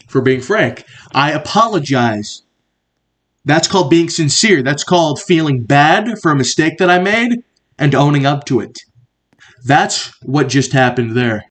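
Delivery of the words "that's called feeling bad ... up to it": ordinary delivery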